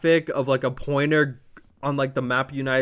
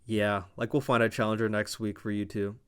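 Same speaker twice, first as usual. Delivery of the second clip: calm reply; 5 semitones lower, 6 dB quieter than usual. Retold or imitated. Nick Aldis calmly answering the angry wrestlers, low and soft. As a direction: lower and softer, unbothered like the boss. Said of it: "And Nick Aldis just calmly says".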